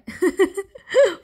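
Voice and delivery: high loud laugh. Real, not acted. Laughing about tea breaks.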